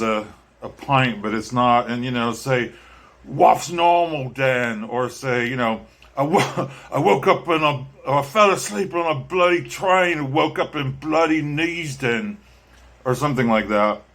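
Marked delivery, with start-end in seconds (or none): 3.2-4.8 mock Cockney. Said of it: "imitate the lines". 6.2-12.4 put-on higher, laughing Cockney accent, quoting a film line; a playful Cockney film-quote impression. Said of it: "speak Cockney".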